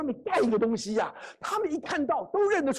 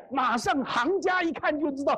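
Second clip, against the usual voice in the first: ordinary delivery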